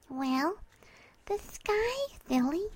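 put-on high, soft girlish voice; gentle teasing. Mare answering her puzzled friend, gently teasing. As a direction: tease lightly in a high little voice.

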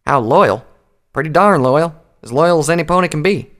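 emphatic Applejack. Acted. Applejack insisting on loyalty to the princess, emphatic. Character answers in story dialogue.

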